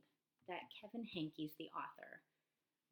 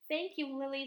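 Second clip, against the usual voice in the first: higher and louder thanks; grateful child voice; 6 semitones higher, 8 dB louder than usual. Acted. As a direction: thank her brightly. Wilson thanks Lily.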